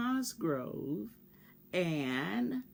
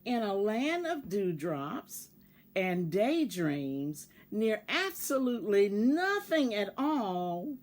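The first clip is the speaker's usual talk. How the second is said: lively read-aloud voice, bigger than her chat; storybook narration; 2 semitones higher, 4 dB louder than usual. Acted. Teacher reading a picture book to her class, expressive storytelling. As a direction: tell it like a bedtime story to children.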